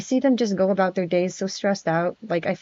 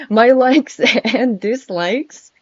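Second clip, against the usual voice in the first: laughing; 4 semitones higher, 5 dB louder, much slower than usual. Real, not acted. Reads the slide title while laughing.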